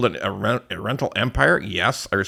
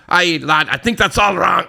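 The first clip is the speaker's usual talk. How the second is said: loud, higher mock Scottish accent; 6 semitones higher, 5 dB louder than usual. Teetotal Irishman posting a complaint, in an accidental Scottish accent. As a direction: put on a hearty Scottish accent. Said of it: "Oh, that's Scottish".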